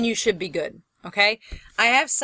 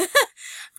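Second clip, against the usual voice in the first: self-amused laugh. Real, not acted. Laughs at own mistake.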